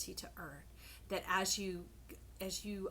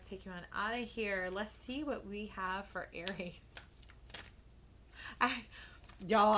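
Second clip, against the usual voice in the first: ordinary talk, nothing marked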